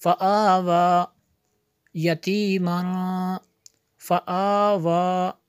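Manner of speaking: slow chant, vowels drawn out; model recitation. Shown as a demonstration. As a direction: show each word in measured recitation. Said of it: "ऐसे इसको पढ़ेंगे". (लय में खींचकर नमूना पढ़ना)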